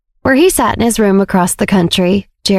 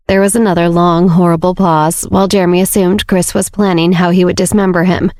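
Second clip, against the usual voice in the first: ordinary delivery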